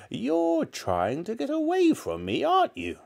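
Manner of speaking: put-on very high, menacing old woman; accusing grandmother. A narrator's reading. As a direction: corner the boy in a high granny voice.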